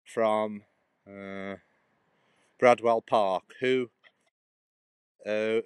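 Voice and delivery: slow inscription reading aloud; solemn reading. Acted. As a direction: read the old gravestone slowly.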